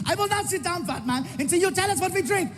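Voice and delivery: German heckler. Retold or imitated. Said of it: "he refuses".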